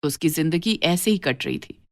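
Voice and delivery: usual speaking voice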